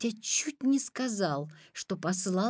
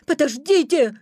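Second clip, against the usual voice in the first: put-on high, loud boyish call; crying out; 6 semitones higher, 7 dB louder than usual. A narrator's reading. Petya calls after the wolf, crying out. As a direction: call out to stop someone. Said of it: "крикнул Петя".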